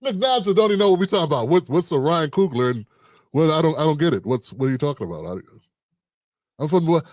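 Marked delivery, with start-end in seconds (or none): 1.5-7.0 lightly voiced confused Englishman, lower than usual; imitating puzzled Brit. Host mimics baffled UK friend Nigel, puzzled. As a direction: drop low, puzzled and polite. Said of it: "Nigel don't talk like that. He from Europe".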